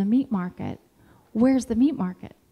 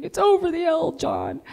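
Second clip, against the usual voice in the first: retold crying scream; 7 semitones higher than usual. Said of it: "she's crying and screaming".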